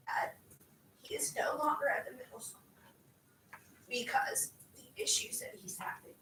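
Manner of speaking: fighting back tears while talking about a student; tearful. Real, not acted. Teacher telling the school board about her struggling student, tearful whisper.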